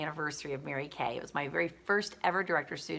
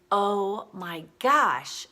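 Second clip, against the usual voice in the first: retold dismay, drawn out slowly and louder; dismayed exclamation; 2 semitones higher, 5 dB louder, much slower than usual. Roommate realizing she left her suit behind, drawn-out dismay. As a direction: stretch it out in mock horror.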